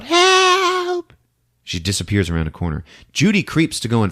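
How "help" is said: much higher, louder cry for help; scream. Retold or imitated. Girl dragged away, screaming for help. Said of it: "She screams out".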